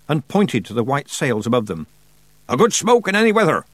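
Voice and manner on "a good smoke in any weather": high, louder sailor's order; commanding. A narrator's reading. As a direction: bellow it as an urgent order. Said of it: "he commanded".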